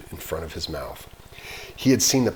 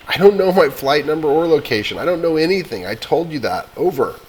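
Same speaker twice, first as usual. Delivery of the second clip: higher, louder frustrated outburst; 8 semitones higher, 8 dB louder than usual. Acted. Boy yelling at the operator in frustration, louder. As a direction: snap back in despair.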